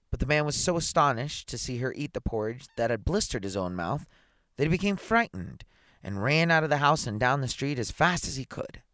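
plain reading voice

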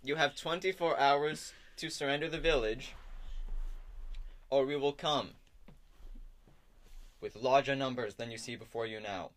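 loud, stately proclamation with long pauses; resume the speech with self-important authority; pompous troop leader. Acted. Troop leader threatens the village after slipping, pompous declamation.

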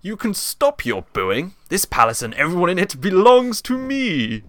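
booming, slow, gloating villain to a booing audience; grand stage baddie, drawn out; pantomime villain. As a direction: claim it all, rising high on the boast. Plays villain Jafar.

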